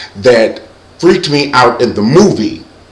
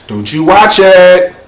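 loud, drawn-out, higher warning; imitating mom, louder and stretched out; mother's stern warning; 6 semitones higher, 5 dB louder, slower than usual. Mother forbidding Thriller video, imitated loud stern warning. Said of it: "my mother's like".

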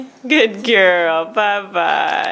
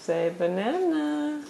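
slow soft coax; 4 semitones higher, 9 dB quieter, slower than usual. Real, not acted. Coaxing baby, slow and soft.

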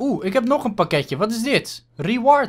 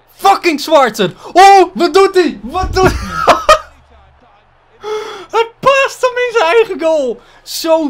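shrieking laughter; 12 semitones higher, 7 dB louder than usual. Real, not acted. Keeper's own goal, laughing.

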